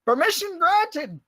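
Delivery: high, slow ship captain's holler; hearty captain's call. Acted. Captain answering someone below, drawn-out high call.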